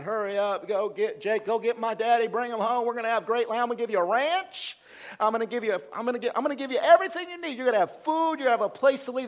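a hint of an overjoyed brother, tumbling over his words; excited Joseph. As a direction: pile on the promises, bursting with joy. Joseph joyfully sends for father.

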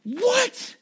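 sudden high squeak of disbelief; spring it up as a stunned question; mock-surprised, high and rising; shocked what. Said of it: "they're going".

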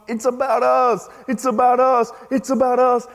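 high, insistent pride clamoring for attention; mock shouting. Pride shouting at believers that it's all about us, mock shout. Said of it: "shouting to us, going".

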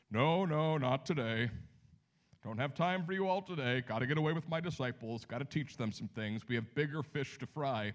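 lightly voiced Jesus brushing the crowd off; a hint of a dismissive Jesus; mock Jesus refusal. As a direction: play Jesus waving them away, half joking.

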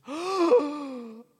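high, loud gasp of horror; mock dramatic gasp; shocked gasp. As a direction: gasp like a big sister wounded by the words. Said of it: "looks at her baby sister and goes".

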